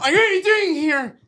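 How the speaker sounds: high, slow, loud confrontation; angry, re-enacted. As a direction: confront the unwelcome stepfather, re-enacted. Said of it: "I'm fucking angry".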